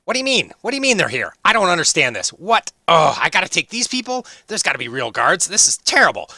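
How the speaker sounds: high, loud, flustered dwarf voice; excited young dwarf. Acted. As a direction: sputter in outrage as the young dwarf. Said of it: "kinda looks over and he says".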